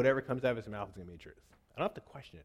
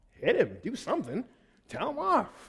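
put-on rising, louder kid's plea; excited child pleading, climbing higher; kid urging dad; 13 semitones higher, 8 dB louder, slower than usual. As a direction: egg dad on like a worked-up boy. Boy urging dad to fight.

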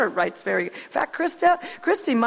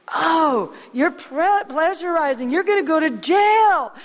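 a higher, louder mock-stern warning; mock parental scolding; 5 semitones higher, 4 dB louder than usual. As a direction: play the parents scaring the boy with jail. Parents scolding the young writer.

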